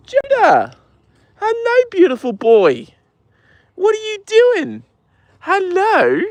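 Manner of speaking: very high, slow cooing to the horse; high pet talk. Real, not acted. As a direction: owner crooning hello to his horse, very high.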